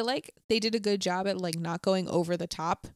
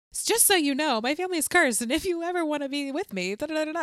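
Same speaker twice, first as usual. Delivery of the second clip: high-pitched rattled-off spiel, play-acted; speed-dating disclaimer; 7 semitones higher, faster than usual. Her warning each speed date about the curse, mock rote spiel. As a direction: recite a well-worn warning in a raised voice. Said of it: "imagine her during, like, speed dating".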